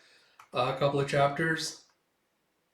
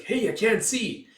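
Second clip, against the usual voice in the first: a higher, slowed whine from the back row; high, slow complaining spectator; 9 semitones higher, 5 dB louder than usual. Retold or imitated. A spectator stuck behind the crowd whines, high and slow. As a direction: stretch it out, craning to see. Audience member blocked from stage.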